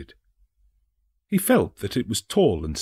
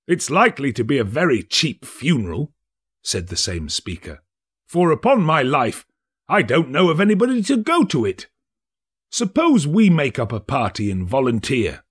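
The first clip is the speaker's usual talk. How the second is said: louder, higher scoffing merchant; making light of a cheap funeral; lightly voiced callous businessman; fat merchant, mocking; 5 semitones higher, 4 dB louder than usual.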